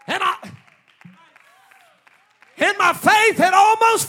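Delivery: shouted, high revival sermon; preacher shouting to rouse the congregation; full-force preaching. Real, not acted. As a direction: proclaim it in ringing phrases, stretching the last words.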